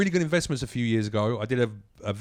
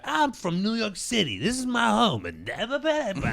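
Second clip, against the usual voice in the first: generic comic opener; 11 semitones higher than usual. Retold or imitated. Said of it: "a couple of minutes at the top are like".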